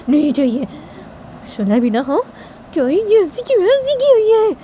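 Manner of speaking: high-pitched fuming to oneself; angry self-talk. A narrator's reading. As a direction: work yourself up into a shrill complaint. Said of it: "Shanaya के ऊपर गुस्सा करने लगती".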